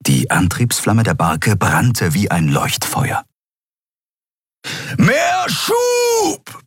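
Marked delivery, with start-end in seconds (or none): 5.0-6.6 high, strained full shout; roared command. A narrator's reading. Keru orders more thrust across the ship, roaring. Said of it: "brüllte Keru quer durch das Schiff".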